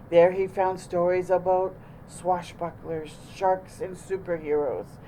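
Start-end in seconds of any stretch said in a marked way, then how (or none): none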